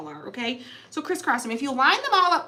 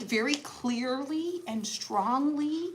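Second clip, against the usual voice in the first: very slow, stretched-out words; mock careful enunciation; 2 semitones higher, 4 dB quieter, much slower than usual. Wife justifying her yelling, slow mock-careful delivery. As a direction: spell it out with exaggerated patience.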